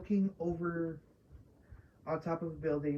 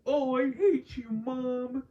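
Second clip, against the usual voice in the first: mocking a whiny teen, high and louder; hammed-up sulky brat voice, pitched up; pouting son; 6 semitones higher, 5 dB louder, slower than usual. Mocking Herschel pouting at his mom, whiny and high. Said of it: "is him pouting and is like".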